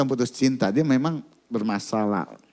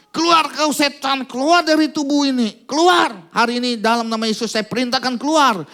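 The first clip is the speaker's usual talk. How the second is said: mock exorcism command; 10 semitones higher, 4 dB louder than usual. Pastor acting a frustrated deliverance prayer, commanding shout. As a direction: order the demon out with full force.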